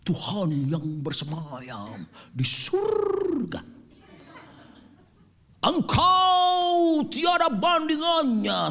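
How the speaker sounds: slow, lofty poetic prayer voice rising to a shout; mock a showy prayer leader, drawing out every word; pompous prayer leader. Preacher mocking a flowery prayer leader, slow and grand. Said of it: "Ada orang berdoanya begini".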